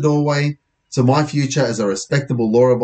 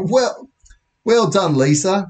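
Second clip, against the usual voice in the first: higher, milder British accent attempt; bad British accent, retried; 8 semitones higher, slower than usual. Police officer praising a girl, in a toned-down British accent. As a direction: have another go at a British officer. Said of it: "worst British accent I've ever tried".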